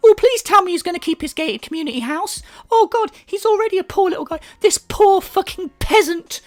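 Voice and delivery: high-pitched fake anguish; mock pity. Commentator mocking a gated-community owner as a poor guy, mock pity.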